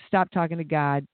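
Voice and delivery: usual speaking voice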